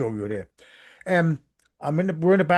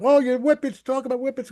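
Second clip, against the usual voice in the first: excited; 12 semitones higher, 4 dB louder than usual. Retold or imitated. Percy runs up excited.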